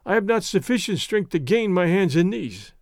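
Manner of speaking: ordinary delivery, nothing marked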